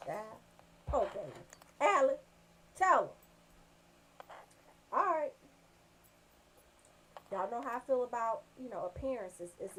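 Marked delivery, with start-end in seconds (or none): none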